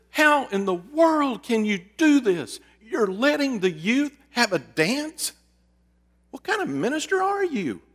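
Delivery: high-pitched mock tirade, slowed; retold screaming. Woman scolding the pastor over the dance, mock outrage. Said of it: "She was screaming at me".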